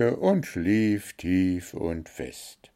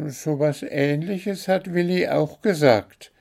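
high bird voice, a touch of remorse; contrite little bird; 8 semitones higher than usual. A narrator's reading. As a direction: bird owning up, lightly crestfallen. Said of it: "meinte nun der kleine Vogel zerknirscht".

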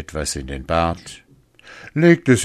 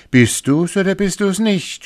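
high, eager questioning husband; 13 semitones higher, 6 dB louder than usual. Acted. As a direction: ask it high and hopeful. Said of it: "sprach er".

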